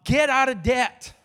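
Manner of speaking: loud, high, drawn-out command; urgent preacher's charge, punched loud; forceful exhortation. Real, not acted. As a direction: call it out slowly to the congregation.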